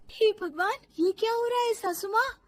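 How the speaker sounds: high, loud, rising disbelief; astonished. Acted. Daughter-in-law seeing mother-in-law freeze, astonished. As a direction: gasp the question in amazement.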